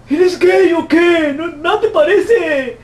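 slow jeering imitation; mocking heckler. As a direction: stretch it out to ridicule. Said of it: "quoting hecklers again".

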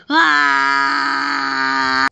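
shouted cry of pain; scream. Acted. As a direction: scream as if hit. Victim scream.

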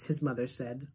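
plain reading voice

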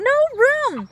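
very high loud shout; loud high mock snarl; jaguar snarls. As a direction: snap at the hummingbird to keep away. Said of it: "Big Boy Jaguar snarls".

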